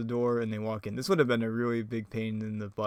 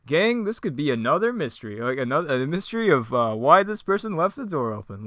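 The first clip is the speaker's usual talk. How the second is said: raised, louder cartoon leader voice; Fred; 4 semitones higher, 6 dB louder than usual. Fred announcing a mystery to the gang, raised put-on voice. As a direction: pitch the mystery to the group, hammed up. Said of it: "Fred's like".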